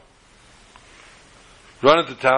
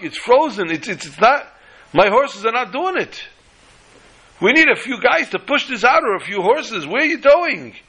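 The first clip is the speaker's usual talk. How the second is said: much higher, urgent running plea; frantic protest; 9 semitones higher than usual. Retold or imitated. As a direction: chase him, desperate to explain. Wagon driver chasing, protesting.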